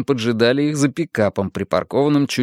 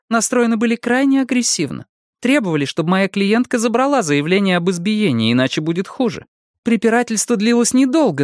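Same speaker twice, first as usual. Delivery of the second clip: higher, firm woman's voice for the lawyer; female lawyer voice; 10 semitones higher than usual. A narrator's reading. Narrator voices female lawyer Annet.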